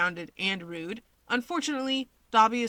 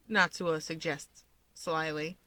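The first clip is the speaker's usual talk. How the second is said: usual speaking voice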